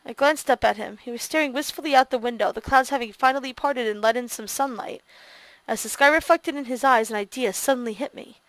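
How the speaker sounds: low, soft narration read; story narration. A narrator's reading. Player quietly reads the narration aloud.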